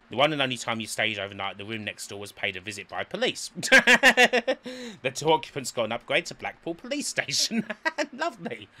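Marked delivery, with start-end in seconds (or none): none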